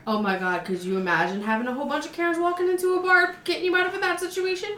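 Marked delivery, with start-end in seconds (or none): 1.4-4.7 high, fast and excited; gushing with amused disbelief. Real, not acted. Amused disbelief at Karen image.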